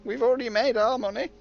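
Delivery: high-pitched mock gloating voice; loud, cartoonishly high scheming boss; [name]. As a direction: crow about the riches in a silly high voice. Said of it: "and, and just go".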